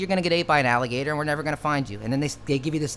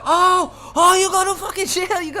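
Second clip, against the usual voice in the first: very high, loud mock Indian accent shout; hurt shopkeeper shouting a threat in a mock Indian accent; clerk yelling in mock accent; 8 dB louder than usual. Clerk yelling after being hit.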